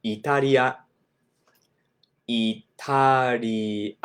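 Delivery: Italia said i-ta-ri-a, the second slow; イタリア; Japanese Italia. Shown as a demonstration. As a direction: Italia with tapped r. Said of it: "The country of Italy".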